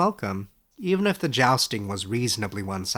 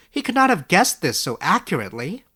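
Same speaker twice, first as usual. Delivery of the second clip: Edric's voice; 6 semitones higher, 5 dB louder than usual. Acted. Young scholar asks his old teacher, amazed and higher. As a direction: ask it wide-eyed, a step higher.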